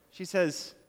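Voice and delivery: normal talking voice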